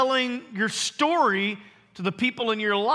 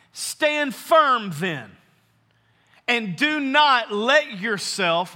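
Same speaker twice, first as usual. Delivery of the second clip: slow, weighty scripture reading; solemn, slow verse reading; read nice and slow; much slower than usual. Shown as a demonstration. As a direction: give every word time to sink in. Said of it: "I'm gonna read it nice and slow".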